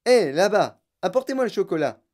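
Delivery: brisk shouted order; calling out. A narrator's reading. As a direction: call across a big room. Calling to workers across the room.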